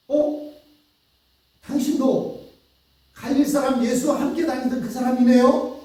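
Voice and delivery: high, pointed voice of a maid spotting Peter; a young maid singling out Peter by the fire, high and accusing; accusing servant girl. Acted. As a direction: catch him out, startled and sure.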